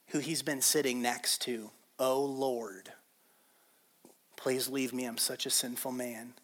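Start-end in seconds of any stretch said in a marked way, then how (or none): 2.0-6.3 low, hushed confession; awed realization. Retold or imitated. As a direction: say it softly, as it sinks in. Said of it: "He just realized who he's".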